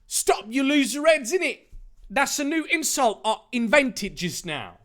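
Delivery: high-pitched character yell. Acted. Jack stopping a fight at the party, yelling. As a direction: call out high to break it up. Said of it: "Jack yelled".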